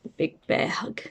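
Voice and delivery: softly whispered chapter title. Acted. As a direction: breathe the title almost silently. Chapter title read aside.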